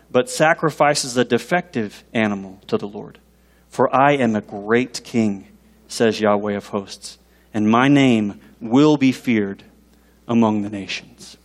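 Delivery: low, slow, solemn reading; scripture reading. Acted. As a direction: read the verse slowly, with weight. Reads scripture aloud.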